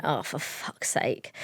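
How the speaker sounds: slow, unvoiced, weary whisper; muttered exasperation. Woman quietly whispering her dread when her friend brings bad news. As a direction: breathe it out slowly under your breath.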